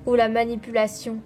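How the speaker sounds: dramatic next-episode voiceover; trailer narration. Acted. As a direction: tease the next episode with suspense.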